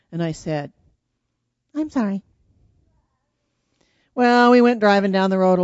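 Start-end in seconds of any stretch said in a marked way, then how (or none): 1.7-2.2 quick, lighter forced apology; made to sound meant. Retold or imitated. Child apologizing after Mom's scolding, short and pretended. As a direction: redo the sorry briefly, pretending to mean it. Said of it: "like you mean it".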